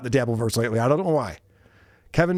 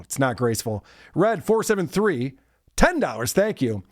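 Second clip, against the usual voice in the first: usual speaking voice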